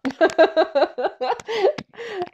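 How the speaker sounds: high burst of laughter; real laugh. Real, not acted. Laughing at herself for wanting to cheat, a high real laugh. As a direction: laugh at being caught out.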